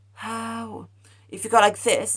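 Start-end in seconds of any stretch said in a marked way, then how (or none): 0.1-0.9 muffled mumble. Shown as a demonstration. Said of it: "Your song would sound like".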